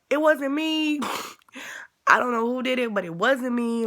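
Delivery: high, loud nervous pleading with a shaky laugh; frantic high-pitched protest; panicked denial. Retold or imitated. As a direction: blurt it out, scared you're in trouble. Denying to the counselor, scared.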